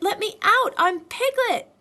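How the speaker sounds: loud high protest; loud desperate Piglet; protesting yell. A narrator's reading. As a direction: squeal to be let out.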